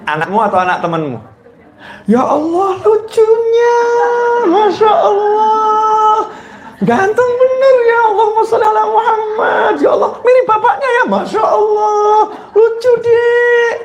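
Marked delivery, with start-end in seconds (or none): 2.1-13.7 put-on high, gushing mother cooing over a baby; gushing over friend's baby. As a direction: coo over the guest's baby, much higher. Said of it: "Temen ibu datang bawa anaknya". (ibu memuji-muji anak teman dengan suara tinggi)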